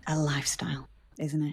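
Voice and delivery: dropped, near-whispered punchline; hushed tagline reveal. Pretend. Host unveiling her sales tagline to co-host, hushed. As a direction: land it softly, almost whispering.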